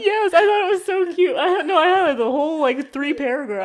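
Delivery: high, loud laughing confession; giddy gushing. Real, not acted. Admitting she highlighted the lines, giddy. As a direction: giggle it out, delighted. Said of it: "gushing over pickup lines".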